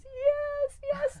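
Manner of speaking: very high, loud confirmation; imitated tearful owner. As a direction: imitate an overcome owner squealing agreement. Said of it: "And she goes".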